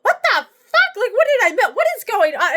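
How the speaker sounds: high-pitched, incredulous reenactment; retold disbelief. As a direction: replay your baffled alarm, starting very high. Retold shock at ex yelling back.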